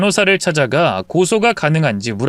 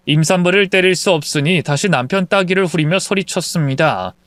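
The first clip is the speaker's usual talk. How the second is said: ordinary delivery, nothing marked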